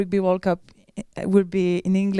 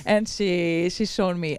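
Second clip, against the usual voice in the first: ordinary delivery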